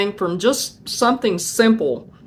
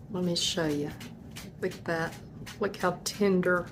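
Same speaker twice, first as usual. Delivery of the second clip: hushed; 13 dB quieter than usual. Real, not acted. Quietly showing the finished roast.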